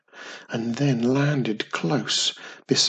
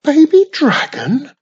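high, loud excited squeal; 14 semitones higher, 12 dB louder than usual. A narrator's reading. The witch finishes the question about the hatchling, thrilled and loud. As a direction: burst out with delight. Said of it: "in excitement".

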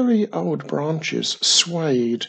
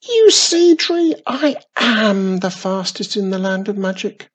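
high, loud delighted squeal; 8 semitones higher, 5 dB louder than usual. A narrator's reading. Witch squealing with delight at the tree. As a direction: crow with joy. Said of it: "squealed with delight".

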